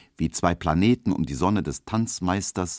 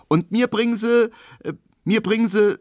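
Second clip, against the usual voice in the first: put-on hesitating girl; 6 dB louder than usual. Emma hesitating over her order.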